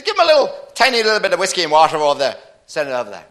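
loud, rapid-fire Scotsman; excitable Scottish drinker shouting an order; Scottish accent. Acted. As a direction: rattle it off high and loud in broad Scots. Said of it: "The Scotsman said".

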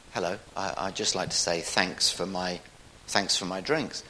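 mild, hushed politeness; soft-spoken. Acted. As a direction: say it softly and humbly, a touch hesitant. Jesus thanking the three drinkers.

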